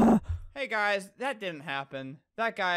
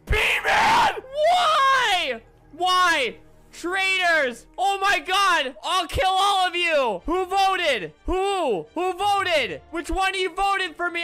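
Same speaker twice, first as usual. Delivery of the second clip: furious shouting; raging; 4 semitones higher, 6 dB louder, much slower than usual. Real, not acted. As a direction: yell at the traitors in fury. Voted out, raging.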